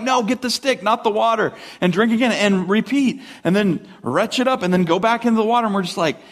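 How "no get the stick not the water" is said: high-pitched, pleading mock command; retold dog scolding. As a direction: redirect the puppy in mock exasperation. Retelling redirecting his dog from water.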